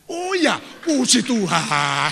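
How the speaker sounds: high, loud surprised joy; surprised thanks. Retold or imitated. Preacher retelling his joy at good news, loud and high. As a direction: burst out in grateful surprise. Reacting to paid forum news.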